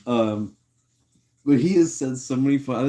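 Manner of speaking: normal talking voice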